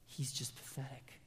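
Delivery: soft whispered aside. Real, not acted. Preacher confiding to the congregation about Mephibosheth in a whisper. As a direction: drop to a whisper.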